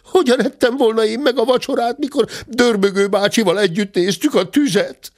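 high, protesting little bear voice; indignant cub. Acted. The cub defending himself to the squire, indignant and high. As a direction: plead innocence, wounded.

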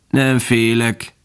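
slow, raspy grasshopper; reszelős szöcskehang. A narrator's reading. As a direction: rasp it out slowly, like an insect. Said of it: "felelte a szöcske reszelős hangon". (lassú, reszelős hang)

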